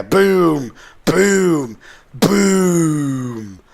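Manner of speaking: three loud, high "Boom!" cannon shots, the last one drawn out. Acted. Narrator voicing the pirate cannon fire loudly. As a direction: mouth the cannon fire big and booming. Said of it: "she heard a cannon fire".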